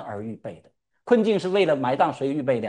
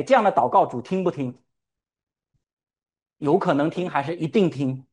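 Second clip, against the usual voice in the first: usual speaking voice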